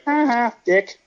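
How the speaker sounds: high, smug mock sneer; smug retort. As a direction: rub it in with a cocky sneer. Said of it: "I just look at the guy like".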